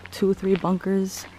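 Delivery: usual speaking voice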